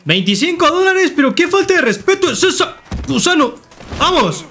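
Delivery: high-pitched, indignant squawk; mock outrage. Seller insulted by the buyer's twenty-five dollars, mock outrage.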